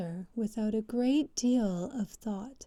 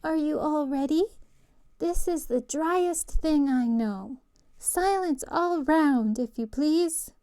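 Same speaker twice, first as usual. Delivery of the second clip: higher, louder, pompous mouse voice; important air; 6 semitones higher, 6 dB louder than usual. Acted. Mouse addressing the soggy crowd, pompous and loud. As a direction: play the self-important lecturer calling for silence. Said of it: "with an important air".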